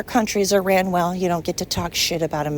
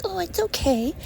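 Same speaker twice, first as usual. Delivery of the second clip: much higher, mock-soothing sing-song; mock coddling; 10 semitones higher, 3 dB quieter than usual. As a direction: mimic people who baby everyone. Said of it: "everybody coddling people and being like".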